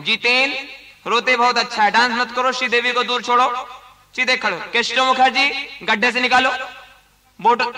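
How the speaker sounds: usual speaking voice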